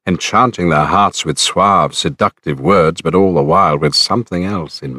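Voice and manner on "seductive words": ordinary delivery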